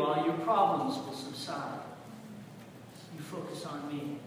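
soft, low, earnest voice; gentle counsel. Acted. God telling Elijah to focus on him, gentle counsel. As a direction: speak quietly, as God steadying someone.

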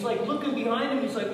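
ordinary delivery

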